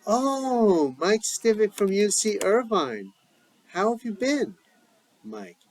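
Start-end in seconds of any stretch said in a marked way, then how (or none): 0.0-4.6 bright, high delighted greeting; light up with happy recognition. Acted. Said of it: "Idina, lighting up".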